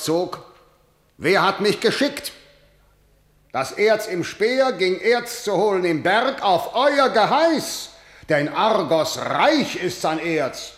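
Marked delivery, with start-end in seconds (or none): 3.5-7.9 high, forceful declaiming; angry retort. Acted. Kreon blaming the elders who sent him, heated and rising. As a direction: build to a raised, accusing last word.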